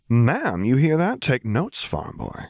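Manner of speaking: delighted "Ma'am" with teasing emphasis; gleeful teasing. A narrator's reading. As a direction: crow over "Ma'am" to tease him. Mara gleefully repeats Piet's ma'am.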